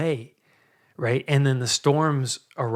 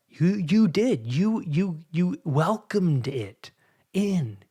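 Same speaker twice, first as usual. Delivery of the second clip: stern God rebuking; 5 semitones higher, slower than usual. Acted. God scolding Cain in retelling.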